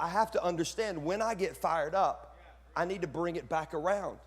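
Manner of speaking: normal talking voice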